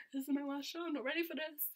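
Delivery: high retold wail, understated; mock dismay. Fan dreading the last show, high mock dismay. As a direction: whine it high, half in jest.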